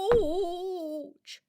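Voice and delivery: Ouch! stretched into a long crying wail; drawn-out pained cry. Acted. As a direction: let the Ouch! sag and wail. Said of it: "someone with a headache".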